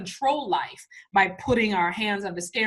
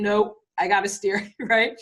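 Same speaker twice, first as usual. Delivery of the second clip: usual speaking voice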